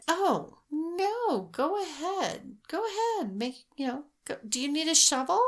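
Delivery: high-pitched, airy, over-accommodating act; imitating an obliging woman. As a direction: wave them on in a put-on high voice. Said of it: "This is how she did it".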